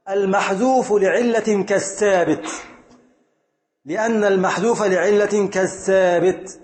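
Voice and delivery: slow and deliberate, word by word; slow rule recitation. Shown as a demonstration. Teacher drilling the grammar rule to students, slow and deliberate. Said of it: "خذ هذه القاعدة".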